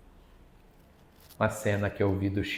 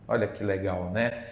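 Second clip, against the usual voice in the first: ordinary talk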